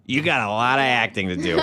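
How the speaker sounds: grandfather voice. Shown as a demonstration. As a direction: say it like a wise old grandfather. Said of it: "I just said that like a grandfather".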